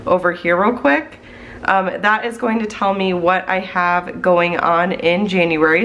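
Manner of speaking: ordinary talk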